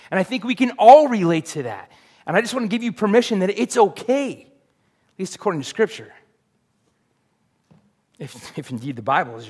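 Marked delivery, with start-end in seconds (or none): none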